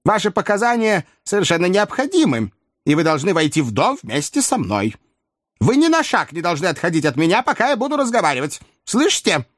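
put-on high, insistent old-lady voice; insist, pitched well up throughout. A narrator's reading. Old lady demanding coachman stay by her, insistent high voice. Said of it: "возразила старая леди".

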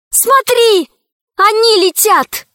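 high, loud sudden shout; крик. A narrator's reading. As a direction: yell it out in alarm. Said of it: "Алиса вдруг не закричала". (Алиса кричит дракону: громкий крик)

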